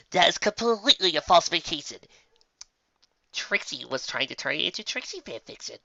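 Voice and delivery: usual speaking voice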